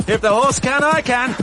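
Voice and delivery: loud, high exhilarated shout; shouting while riding. Acted. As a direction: yell it out over the wind.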